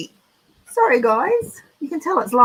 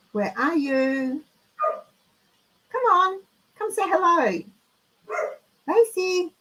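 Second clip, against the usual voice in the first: calling the dog; 5 semitones higher than usual. Real, not acted. Calling the dog through house.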